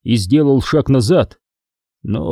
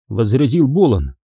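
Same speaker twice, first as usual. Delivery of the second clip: ordinary delivery, nothing marked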